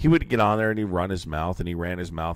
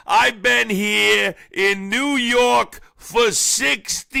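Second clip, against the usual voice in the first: much higher, very slow, loud drawn-out voice; Curtis Sliwa impression; 13 semitones higher, 7 dB louder, much slower than usual. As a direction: stretch every word out high and loud like Sliwa lecturing the governor. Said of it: "Do the Sliwa voice".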